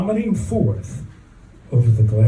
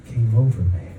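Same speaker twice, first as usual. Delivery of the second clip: ordinary delivery, nothing marked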